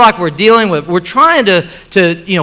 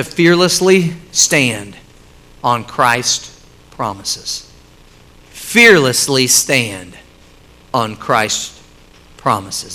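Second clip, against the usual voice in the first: slow hushed emphasis; 10 semitones lower, 5 dB quieter, much slower than usual. Real, not acted. Preacher slows, softens the point.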